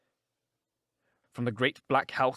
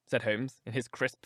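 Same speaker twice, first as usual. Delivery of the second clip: ordinary delivery, nothing marked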